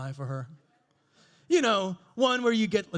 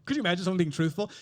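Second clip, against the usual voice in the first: normal talking voice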